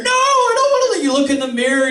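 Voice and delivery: high, fast frantic protest; hammed-up high-pitched dread; panicked refusal. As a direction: refuse in a mock-terrified rush.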